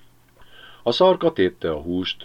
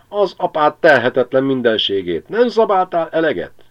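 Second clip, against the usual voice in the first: swearing at the dog, higher and louder; furious curse; 9 semitones higher, 6 dB louder than usual. Acted. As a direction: bellow in anger at the greedy dog. (Pilátus dühösen szidja a kutyát)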